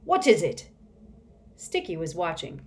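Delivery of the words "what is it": loud, raised-pitch demand. A narrator's reading. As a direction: demand an answer across the room. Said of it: "demanded from across the room".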